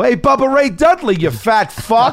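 high, loud taunt at a wrestler; heckling yell. Retold or imitated. Fan yelling at a wrestler across the parking lot, heckling. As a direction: holler it across a parking lot, drawing out the end. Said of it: "You know, you yell".

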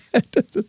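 high-pitched burst of laughter; amused laugh. Real, not acted. Laughs at the joke just read.

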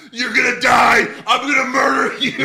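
high, loud mock screaming threat; raging gamer. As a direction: yell like an unhinged wrestler. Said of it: "He goes".

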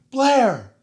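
high, loud call of the name; yelling the dog's name. Retold or imitated. Owner yelling at the runaway dog, high and loud. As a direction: yell the name to stop her in her tracks. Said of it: "when I would yell at her, I would be like".

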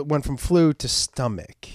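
normal talking voice

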